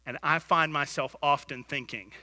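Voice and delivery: normal talking voice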